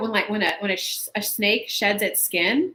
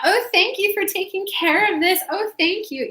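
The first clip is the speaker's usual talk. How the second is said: mock gushing thanks; 9 semitones higher than usual. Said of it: "not just be that".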